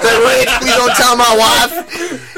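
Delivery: slow, high, loud Southern plea; Southern accent. Retold or imitated. Chuck pleading with Rich about his wife, drawn-out Southern accent. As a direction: beg in a drawn-out imitated Southern twang. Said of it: "He's a Southern guy".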